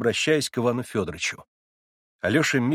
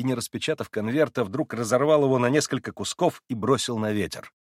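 ordinary delivery, nothing marked

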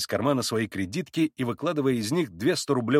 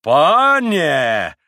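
long, loud, indignant cry; threatening shout; 5 semitones higher, 10 dB louder than usual. A narrator's reading. Both Poles round on Mitya, shouting with menace. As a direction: bellow it as a warning. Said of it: "прокричали оба пана с угрозою".

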